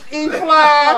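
loud, high words through real laughter; laughing. Real, not acted. Singer laughing at his own note to the crowd, laughing. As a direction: crack up while boasting. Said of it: "did you hear that sound".

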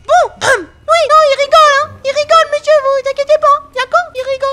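loud reassurance. Real, not acted. Loud reassurance after laugh.

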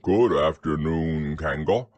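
low-pitched bear greeting; deep, polite bear hello; Pooh. Acted. Pooh greets Kanga in character.

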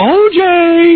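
high, drawn-out mock cheer; crowd cheer. Host imitates everyone running around cheering, high drawn-out holler. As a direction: call it out long and high like a fan. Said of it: "everyone will run around and go".

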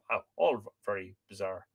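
usual speaking voice

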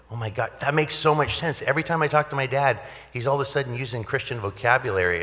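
high, quick retold amazement; amazed realization. Son reacting to chaplain's news on phone, amazed realization. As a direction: rush it out high as it dawns on you.

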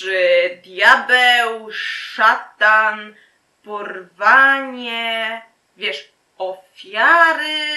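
loud, drawn-out words of doom; mock ominous. Captor hints at devil and sacrifices, mock ominous. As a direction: stretch each word out loud and slow.

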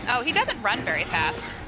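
ordinary talk, nothing marked

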